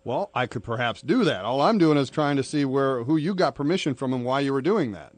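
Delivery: normal talking voice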